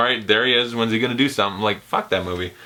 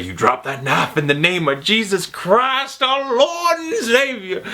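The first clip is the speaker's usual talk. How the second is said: slow, high, drawn-out preacher voice; hammed-up old church lady rebuking the mugger; preaching church lady; 9 semitones higher, slower than usual. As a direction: stretch it out like a holy command. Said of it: "And she's like".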